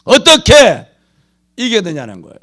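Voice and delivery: loud, high, hammering sermon voice; preaching at full force. Acted. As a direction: shout the point home to the congregation. Preaching climax. (온 힘을 다해 외치는 설교)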